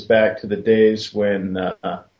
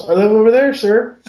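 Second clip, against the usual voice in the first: put-on polite little boy; 11 semitones higher, 6 dB louder than usual. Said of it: "I'd point to the house".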